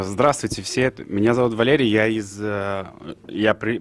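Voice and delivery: ordinary delivery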